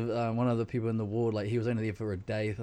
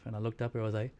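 ordinary talk, nothing marked

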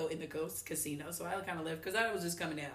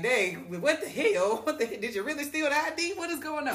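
loud, higher mock disbelief; imitating baffled friend; 8 semitones higher, 5 dB louder than usual. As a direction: act out a stunned friend's reaction. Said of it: "My friend over there looking like".

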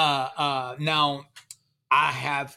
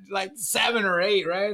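ordinary talk

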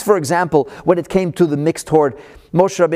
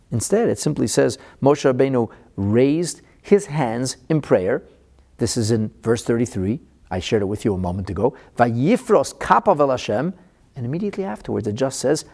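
normal talking voice

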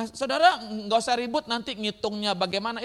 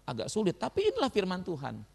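ordinary talk, nothing marked

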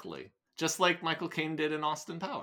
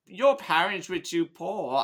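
slow, higher Cockney with added flavor; played-up Cockney; 4 semitones higher, 3 dB louder than usual. Shown as a demonstration. Reader showing off the added flavor, in played-up Cockney. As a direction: spice up the Cockney, drawn out. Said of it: "adding a flavor onto it".

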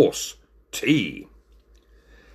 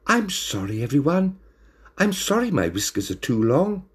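higher, forlorn apology; close to tears; 7 semitones higher than usual. A narrator's reading. Sacked waiter apologises to everyone, mildly tearful. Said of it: "Binnicky was close to tears".

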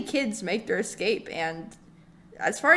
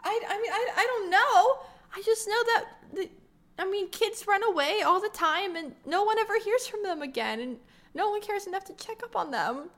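pitched-up anxious lunatic voice; high nervous rambling; 7 semitones higher, 4 dB quieter than usual. Acted. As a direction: babble it high and jittery.

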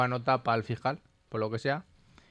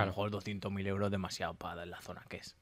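quiet aside; 4 semitones lower, 11 dB quieter than usual. Retold or imitated. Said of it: "porque dice".

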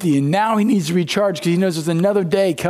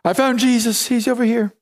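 mock relief; 5 semitones higher than usual. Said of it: "they're like".

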